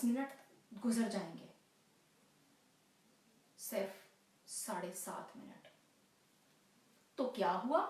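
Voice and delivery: stage lover, wavering between confidence and brooding; restless waiting lover. Acted. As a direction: reassure yourself while counting the minutes.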